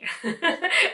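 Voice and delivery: short high laugh. Real, not acted. Laughs during the sign-off.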